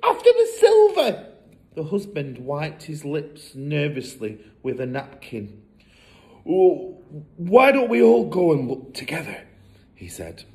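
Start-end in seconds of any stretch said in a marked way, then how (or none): 0.0-1.3 very high, loud, panicky; frantic hiss. A narrator's reading. Mother fears for the silver, frantic. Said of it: "hissed the mother". 6.5-9.4 higher, hesitant father; nervous suggestion. A narrator's reading. Nervous husband avoids going alone, uneasy.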